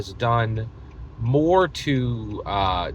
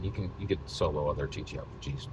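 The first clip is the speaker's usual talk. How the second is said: normal talking voice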